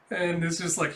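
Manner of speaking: ordinary talk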